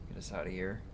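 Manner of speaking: normal talking voice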